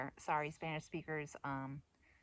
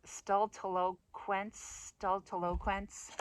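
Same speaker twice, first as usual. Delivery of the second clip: very slow, syllable by syllable, rising at the end; tentative drawn-out sounding-out; careful attempt; 2 semitones higher, much slower than usual. Shown as a demonstration. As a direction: feel out an unfamiliar word. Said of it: "I still can't pronounce right".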